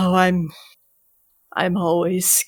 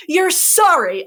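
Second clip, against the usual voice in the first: scornful echo, higher and louder; 10 semitones higher, 6 dB louder than usual. Acted. Curling repeats her apology.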